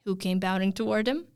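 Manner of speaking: plain reading voice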